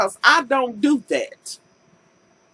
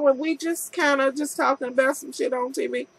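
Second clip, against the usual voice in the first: ordinary talk, nothing marked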